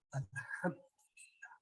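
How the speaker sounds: slow, breathy whispered alhamdulillah. Acted. Teacher whispering the answer to the class, slow. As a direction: breathe the word out slowly, barely voiced.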